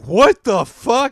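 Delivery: loud, high, slow disbelief; mock shocked. Imitating the stunned club audience, loud mock disbelief. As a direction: stretch it out in hammed-up shock.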